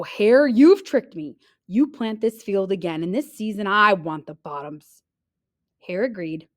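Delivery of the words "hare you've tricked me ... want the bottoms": angry bear. Acted. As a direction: accuse him firmly.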